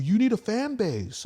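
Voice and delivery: very high, slowed mock voice; high whiny imitation; mocking friends. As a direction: squeak it out like a nagging friend. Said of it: "everyone bugged me".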